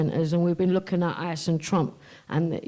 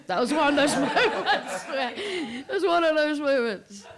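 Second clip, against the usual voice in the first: high, laughing and close to tears; 8 semitones higher than usual. Real, not acted. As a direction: laugh it off, a bit overwhelmed. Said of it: "let me start again, sorry".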